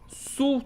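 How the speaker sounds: single out "Su" as the strong syllable; stressed syllable. Shown as a demonstration. Teacher showing the tonic syllable, isolated and stressed. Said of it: "é a mais forte".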